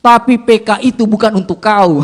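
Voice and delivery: loud, rising punchline delivery; inner voice's twist. Acted. Inner voice revealing the catch to preacher, loud and emphatic. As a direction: hit the catch hard and loud. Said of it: "suara itu muncul lagi".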